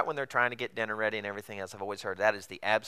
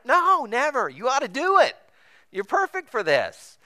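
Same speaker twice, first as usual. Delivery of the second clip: loud, very high mock cheer; hammed-up high-pitched assurance; sarcastic encouragement; 13 semitones higher, 6 dB louder than usual. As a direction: egg them on in a squeal. Sarcastic encouragement to would-be pastor.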